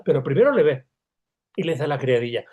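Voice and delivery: normal talking voice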